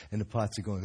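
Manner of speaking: ordinary delivery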